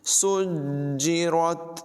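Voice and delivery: सुज्जिरत with the ज pressed hard and doubled, bounced; firm jeem. Shown as a demonstration. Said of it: "जीम को ज़रा ज़ोर लगाकर जमाओं के साथ पढ़ेंगे". (सुज्जिरत में जीम ज़ोर से, क़लक़ला के साथ)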